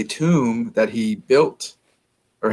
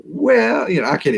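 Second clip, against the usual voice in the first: Southern Belle accent; 7 semitones higher than usual. Shown as a demonstration. Half-hearted Southern Belle "Well," to show how movies get New Orleans wrong. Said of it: "the Southern Belle style accent".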